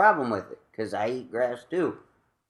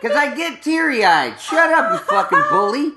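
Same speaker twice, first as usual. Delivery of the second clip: high, loud laughing outburst; laughing protest; 11 semitones higher, 7 dB louder than usual. Real, not acted. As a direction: squeal through laughter at the teasing. Mock outrage at being teased.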